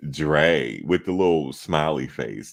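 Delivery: ordinary delivery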